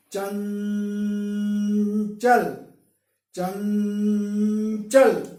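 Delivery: चंचल, nasal sustained on one note before चल; stop on the nasal. Shown as a demonstration. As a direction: say च, hang on the nasal in चंचल, then finish. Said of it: "च बोलकर यहां पर रुक जाएं".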